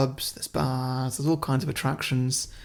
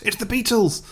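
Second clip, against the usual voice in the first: high-pitched mock shout of alarm; 9 semitones higher than usual. A bug shouting a warning to the crowd, mock shout. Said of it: "someone shouts".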